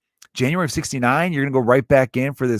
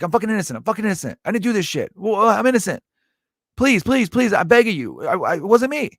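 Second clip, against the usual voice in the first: higher, pleading prisoner voice; a mock desperate plea of innocence, pitched up; 8 semitones higher than usual. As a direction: beg like a wrongly jailed man.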